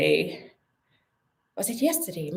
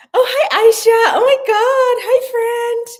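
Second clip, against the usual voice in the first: high, loud squealy hello; excited greeting; 10 dB louder than usual. Real, not acted. Greeting her friend Aisha with a loud squeal. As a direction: gush over a friend arriving.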